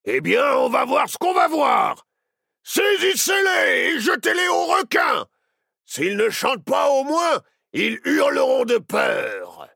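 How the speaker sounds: loud, high bellowed threats; furious captain. A narrator's reading. Captain orders children thrown to sharks, furious shouting. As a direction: roar orders to the crew.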